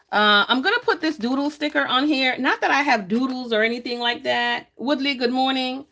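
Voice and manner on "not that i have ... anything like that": normal talking voice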